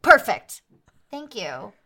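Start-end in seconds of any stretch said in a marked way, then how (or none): 0.0-0.6 "Perfect!" called out loud; loud approval. Acted. Sister loudly approving the brother's pronunciation. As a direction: praise him loudly.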